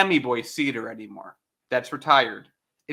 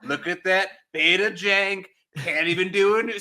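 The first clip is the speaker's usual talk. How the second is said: Trump; 2 semitones higher than usual. Shown as a demonstration. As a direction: stretch the words in a presidential caricature. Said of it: "I can only do Trump".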